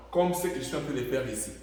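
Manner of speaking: normal talking voice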